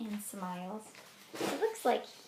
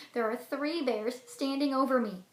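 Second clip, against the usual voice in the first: normal talking voice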